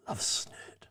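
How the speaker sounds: breathy whispered aside, tossed off under the breath. Real, not acted. Quiz host whispers his love of snert to himself, unvoiced whisper. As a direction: whisper it to yourself between quiz items.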